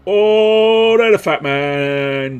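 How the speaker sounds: loud, high, slow burst of glee; delighted. Pretend. Spotting the chunky frame, loud delighted cry. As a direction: greet the fat man with glee.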